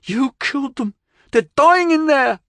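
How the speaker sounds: panicked accusation, voice climbing; Billy Joe yells as his friends die; reckless yell. Acted. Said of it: "Billy Joe yelled recklessly".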